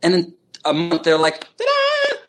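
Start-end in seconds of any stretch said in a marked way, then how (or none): 1.6-2.2 very high showy flourish; triumphant ta-da. Retold or imitated. Teacher showing how his students open up, high triumphant ta-da. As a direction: sing out a high-pitched reveal. Mimics students blossoming within a month.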